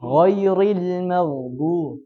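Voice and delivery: Ghairil with a full throaty gh, drawn out slowly; correct gh sound. Shown as a demonstration. Teacher modeling the correct Quranic letter, slow and deliberate. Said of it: "Jangan dibaca ri".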